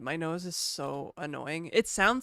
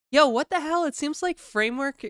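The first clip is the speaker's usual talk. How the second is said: surprised; 9 semitones higher, 9 dB louder than usual. Real, not acted. Sees everyone got free laptops.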